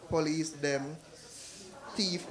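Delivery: normal talking voice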